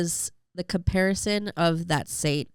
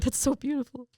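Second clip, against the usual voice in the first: teary gushing quote; 10 semitones higher than usual. Retold or imitated. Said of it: "even people who didn't like me were crying".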